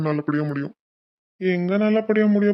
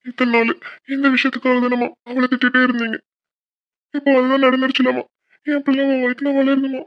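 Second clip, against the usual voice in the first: high, louder tearful pleading to his mother; அழுது மன்றாடுதல்; 8 semitones higher, 4 dB louder than usual. Acted. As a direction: cry out the plea, voice straining high. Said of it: "Prabha, எதுக்கு அழுகுற?". (அம்மாவிடம் அழுகையுடன் உரத்த மன்றாட்டு)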